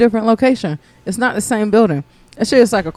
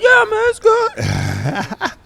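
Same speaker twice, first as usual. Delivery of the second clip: high, slow, choked-back cough; strained stoned voice; 12 semitones higher, much slower than usual. Retold or imitated. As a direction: pretend it's fine while choking. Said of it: "I'm like".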